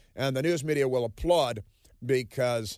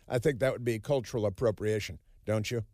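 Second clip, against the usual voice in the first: normal talking voice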